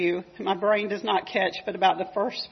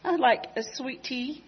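put-on light Scottish child accent, a touch higher; Scottish girl accent; 4 semitones higher than usual. Little girl asking the visitor for sweet tea, light Scottish accent. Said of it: "in her little thick Scottish accent".

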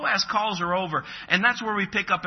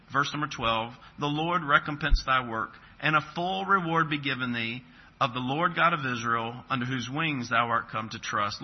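measured, lowered voice reading the Bible aloud; scripture reading; 4 semitones lower than usual. Acted. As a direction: read the verses like a preacher at the pulpit.

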